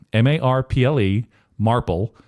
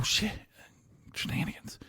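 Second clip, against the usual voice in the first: breathy whispered aside; 16 dB quieter than usual. Real, not acted. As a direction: whisper it under your breath. Aside after a slip.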